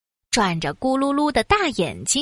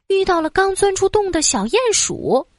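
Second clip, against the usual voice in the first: usual speaking voice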